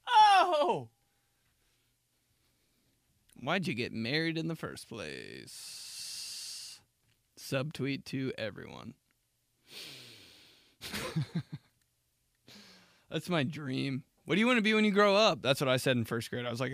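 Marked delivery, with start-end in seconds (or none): 0.1-6.8 mock exclaiming. Imagined remark after divorce. 14.2-15.4 high, fast quoted question; sing-song grown-up asking a kid; high teacher voice. Retold or imitated.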